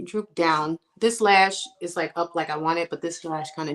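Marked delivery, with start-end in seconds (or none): none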